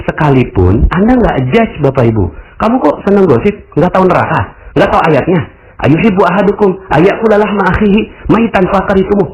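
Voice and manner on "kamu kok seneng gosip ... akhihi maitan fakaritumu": higher, preachy reproach; self-righteous scolding. Pretend. Judgmental person scolding a gossiping woman, higher and preachy. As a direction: lecture the gossiper with scripture. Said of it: "itu yang kita bilang".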